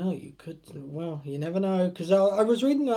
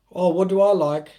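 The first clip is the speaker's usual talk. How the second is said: loud exclamation; 8 dB louder than usual. Real, not acted. Sudden loud answer to chat.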